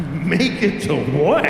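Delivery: slow, stretched-out disbelief; mock astonished kid, rising at the end; incredulous. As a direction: question it in utter bafflement. Child baffled by mother's claim.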